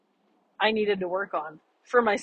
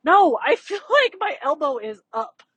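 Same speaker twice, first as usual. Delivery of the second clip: higher, louder protest breaking into a laugh; laughing mock protest about the elbow; frustrated protest; 7 semitones higher, 5 dB louder than usual. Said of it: "I remember getting really frustrated 'cause I'm like".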